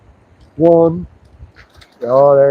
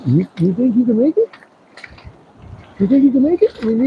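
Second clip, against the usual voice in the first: ordinary talk, nothing marked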